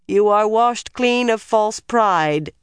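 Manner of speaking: slow, high ritual chant; intoning. A narrator's reading. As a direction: intone it like a solemn rite. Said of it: "Elida intoned".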